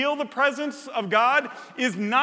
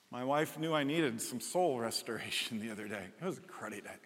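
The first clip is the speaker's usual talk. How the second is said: ordinary delivery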